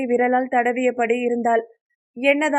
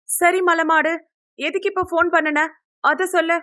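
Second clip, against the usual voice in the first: impatient retort; 6 semitones higher than usual. A narrator's reading. Bickering friend dismisses the insult.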